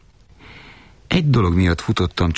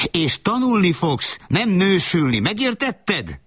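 very high, drawn-out furious scolding; howling fury; 13 semitones higher, slower than usual. Acted. Mother forbidding her son to marry, howling fury. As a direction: lay down the law in a high screech. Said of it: "üvöltötte".

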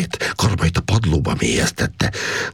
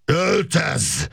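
drawn-out high yell, held back in volume; strained, high acted bellow without real loudness. A narrator's reading. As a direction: yell the order with pitch shooting up. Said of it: "üvöltötte".

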